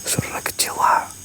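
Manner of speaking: whispered report; hushed so as not to scare it off; real tense whisper. Real, not acted. Hushed report of ghost sound.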